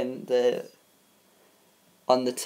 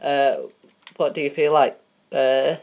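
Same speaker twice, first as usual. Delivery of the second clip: ordinary talk, nothing marked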